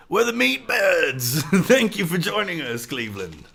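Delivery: hammed-up band greeting to a crowd; mock rock frontman. Plays band greeting concert crowd.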